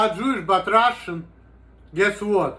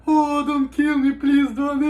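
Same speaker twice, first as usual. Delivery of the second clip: high, whimpering mock begging; mock cowardly plea; 7 semitones higher, slower than usual. Mimicking how critics portray him, high pleading coward voice. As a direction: play the helpless coward begging for mercy. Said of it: "They portray me like this fucking bitch".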